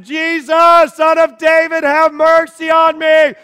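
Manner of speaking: high desperate yell, stretched out; screaming. Acted. Blind man yelling toward Jesus he can't see, screaming. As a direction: yell in every direction at once. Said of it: "He's screaming. He's yelling".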